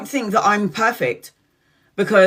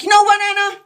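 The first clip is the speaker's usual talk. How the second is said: much higher and louder, indignant; cousin's fired-up voice; 12 semitones higher, 6 dB louder than usual. Retold or imitated. Cousin telling Anna she's had enough, indignant. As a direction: play the cousin bursting out. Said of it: "she was like".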